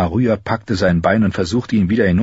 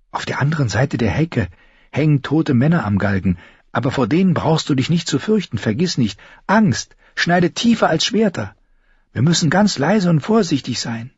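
lightly voiced, higher character; higher, earnest warning; earnest young Arya; 7 semitones higher than usual. A narrator's reading. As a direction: caution a companion, voice raised in pitch.

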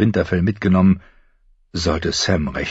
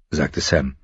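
ordinary talk, nothing marked

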